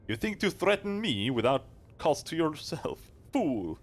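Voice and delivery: high, loud furious rebuke; angry character voice. Acted. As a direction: snap at the fool loudly. Character answers a threat.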